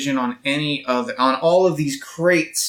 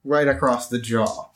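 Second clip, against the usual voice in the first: cocky hero quip; 7 semitones higher than usual. Acted. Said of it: "and I'm like".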